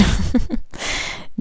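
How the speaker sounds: breathy giggle at herself; airy high laugh. Real, not acted. Reader laughing at her creepy-sounding card, breathy giggle. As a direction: laugh off your own spooky line.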